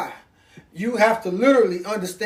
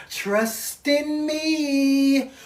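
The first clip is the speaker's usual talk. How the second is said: very slow, hypnotic snake croon; Jungle Book snake; 4 semitones higher, 3 dB quieter, much slower than usual. Retold or imitated. Preacher imitating the Jungle Book snake, slow and hypnotic. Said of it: "Like that snake on, um, Jungle Book".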